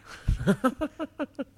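high burst of laughter. Real, not acted. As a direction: crack up at your own voice. Pig voice dissolves into laughter.

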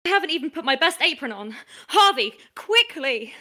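fast, loud flustered rush; hammed-up panic, loud and rushed. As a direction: scramble in a panic to get ready. Panicking before the star arrives.

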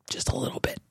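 hushed mock confession; whispered aside. Real, not acted. Host whispering to caller about being offended.

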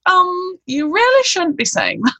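high-pitched, awkward mock correction; imitating disapproving sister. Older sister correcting her wording.

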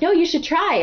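high-pitched imitation of an urging friend; Becca. Friend urging her to try the accent, imitated high. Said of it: "And Becca being like".

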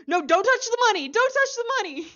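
high, loud frantic yell; urgent warning shout. Retold or imitated. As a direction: shout a panicked warning to the crowd. Agents warning crowd in station.

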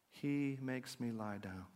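psalm line said slowly at a near whisper; hushed, slow. Shown as a demonstration. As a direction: let it sink in, softly and slowly. Psalm repeated, slower, softer.

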